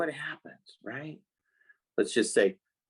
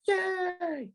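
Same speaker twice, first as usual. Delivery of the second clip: squealed high yay; mock yay. Showing kids a jump from sad to excited, squealed high. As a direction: shriek a sudden yay. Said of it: "You don't go".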